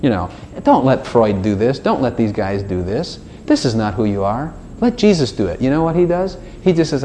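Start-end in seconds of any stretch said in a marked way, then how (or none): none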